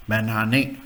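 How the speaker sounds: "Banane" ending in a clear "eh"; pronuncia tedesca. Shown as a demonstration. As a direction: repeat "Banane" as a German model word. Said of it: "Same in German is".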